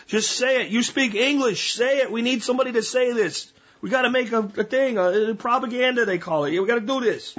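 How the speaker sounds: hammed-up Italian, rushing the order; mock Italian accent. ISIS handler pushes a recruit to speak on camera, in a mock Italian accent. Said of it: "sound Italian".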